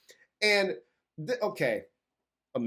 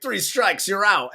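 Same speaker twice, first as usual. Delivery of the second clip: louder, higher mock one-liner; tough-guy quip; 5 semitones higher, 6 dB louder than usual. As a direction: deliver the cheesy baseball line. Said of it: "he's like".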